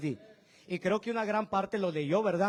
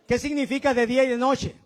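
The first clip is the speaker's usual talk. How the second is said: usual speaking voice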